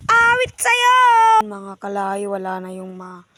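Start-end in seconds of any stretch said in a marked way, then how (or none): 0.1-0.9 high, loud mocking shout. Real, not acted. Owner shouting a taunt at a troublemaker, high and loud. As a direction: fling it at them, pitched way up.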